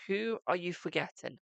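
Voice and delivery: ordinary talk, nothing marked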